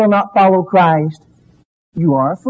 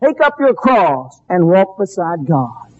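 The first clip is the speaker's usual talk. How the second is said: ordinary delivery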